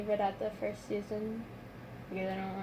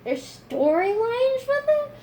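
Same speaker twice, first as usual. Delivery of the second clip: surprised; 12 semitones higher, 10 dB louder, slower than usual. Retold or imitated. Retold disbelief.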